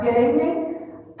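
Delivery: amplified over the PA; through a microphone. Real, not acted. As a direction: speak it into the mic. Get the mic.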